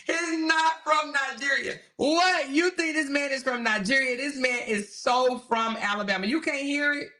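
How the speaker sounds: high-pitched, amused disbelief; incredulous. Real, not acted. Host scoffs at a viewer's Nigeria guess, incredulous.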